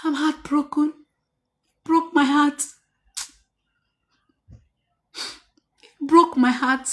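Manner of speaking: hammed-up heartbroken whine; mock heartbroken lament. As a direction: mimic someone moaning about a broken heart. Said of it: "All those".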